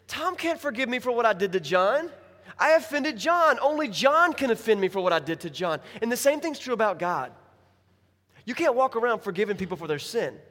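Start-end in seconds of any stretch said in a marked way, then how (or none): 0.1-5.8 exasperated emphasis. Shown as a demonstration. Exasperated punchline of forgiveness illustration.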